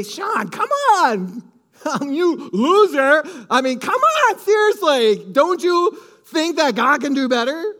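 high, loud hammed-up scolding of a loser husband; mock scolding. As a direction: pretend the wife is berating him, half laughing. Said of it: "She didn't scold me and say".

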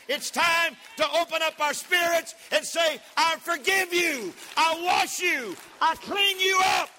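loud, high, slow preacher's shout; preaching at full force. Acted. Pastor shouting to the congregation, full force. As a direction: build up and shout it to the church.

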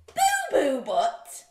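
loud, high echo in disbelief; incredulous. Acted. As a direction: repeat it as if scandalised. Repeats silly name in disbelief.

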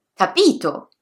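capito stressed on the middle syllable, ca-PI-to; stress on the penultimate. Shown as a demonstration. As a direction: lean on PI in capito. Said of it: "sulla penultima".

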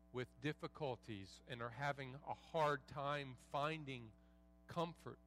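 softly spoken, low pastoral prayer. Real, not acted. Pastor praying for struggling believers in the room, hushed prayer.

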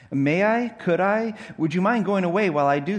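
put-on high, loud, anxious girl's questions; timid request. Jill asks the lion to go away, anxious and high. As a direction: ask in a nervous, lifted rush.

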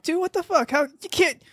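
high-pitched exasperated disbelief; mock outrage. Friend ranting about brother looking too good, in high mock indignation. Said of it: "And I'm like".

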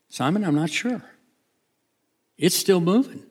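acted uncertainty, rising in pitch; uncertain Andrew.

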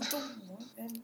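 soft unvoiced whisper. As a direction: breathe it out as a whisper. Aside after the fight.

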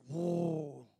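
low, drawn-out "whoa"; imitated orchestra swell. Bridesmaids' music kicking up a notch, low orchestral imitation. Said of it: "with a little orchestra".

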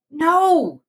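high exultant no; a sudden victory cry; triumphant cry. A narrator's reading. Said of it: "she cried triumphantly".

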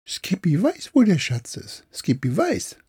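high, chirpy little monkey voice. Acted. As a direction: play a simple-minded pet monkey. Affe Skippy spricht. (hohe Affenstimme)

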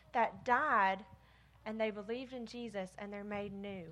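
ordinary delivery

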